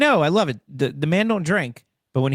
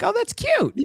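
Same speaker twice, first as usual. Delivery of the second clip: much higher, mock-cooing crowd at something cute. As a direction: coo it like the crowd gushing over a baby. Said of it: "and everybody's like".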